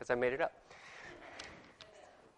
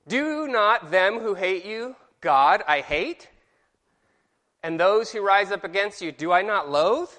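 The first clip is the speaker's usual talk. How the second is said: slow, higher, lingering on Do and God; slow emphatic reading; 5 semitones higher, 5 dB louder, slower than usual. Shown as a demonstration. Teacher reading his own version of the verse aloud. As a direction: lean into each rhetorical question. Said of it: "this is how I think that verse reads".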